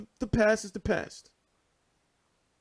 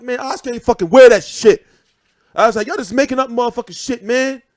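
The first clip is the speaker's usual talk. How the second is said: loud, raised-pitch protest; indignant denial; 6 semitones higher, 13 dB louder than usual. Retold or imitated. Fan defending Oscar to people in the street, indignant shouting. Acted angry defence of his idol.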